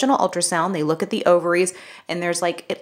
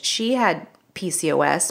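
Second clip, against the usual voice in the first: usual speaking voice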